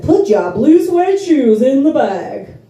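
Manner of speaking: slow, loud [name] drawl. Retold or imitated. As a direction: lay on the [name] croon. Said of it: "This is [name] talking to me".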